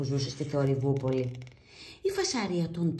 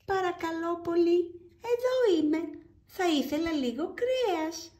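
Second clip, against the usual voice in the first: higher, pleading try to be heard; trying louder; 13 semitones higher than usual. Acted. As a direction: raise the voice to get noticed. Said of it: "ξαναπροσπαθούσε". (ο κύριος Ήσυχος προσπαθεί πιο δυνατά)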